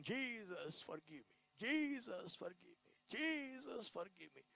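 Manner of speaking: drawn-out plea, each one fading softly; retold crying prayer. Convert recalling his night of crying to Jesus, retold tearful plea. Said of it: "I kept crying".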